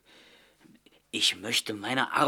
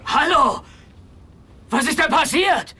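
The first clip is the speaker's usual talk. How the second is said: high, loud, urgent calls; loud, anxious calling; alarmed calling out; 12 semitones higher, 5 dB louder than usual. Acted. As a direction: shout across the street in alarm. Calling out after explosion.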